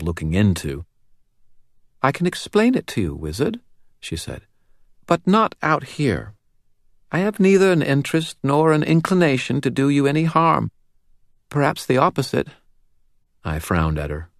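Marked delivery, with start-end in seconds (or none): 2.0-3.6 put-on high, serious child's voice with a faint British lilt; serious little girl, faint British accent. A narrator's reading. Narrator voicing the girl to Dresden, serious and high. 5.1-12.5 put-on high, serious child's voice with a faint British lilt; serious little girl, faint British accent. A narrator's reading. Narrator voicing the girl to Dresden, serious and high.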